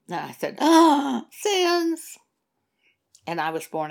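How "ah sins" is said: high, loud gasp of sudden understanding; startled realization. Retold or imitated. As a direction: catch the word sins with a shocked, higher voice. Her startled reply to God.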